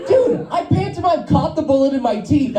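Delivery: normal talking voice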